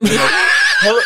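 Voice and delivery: loud, high, sobbing parody; hammed-up tearful wail down the phone; mock wailing hello. Mocks actress's tearful phone answer.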